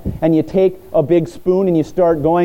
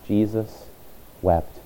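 hushed reverence; 7 semitones lower, 9 dB quieter, slower than usual. Acted. Reverent reading of verse.

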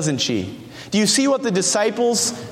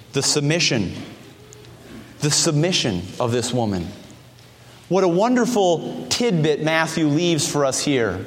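usual speaking voice

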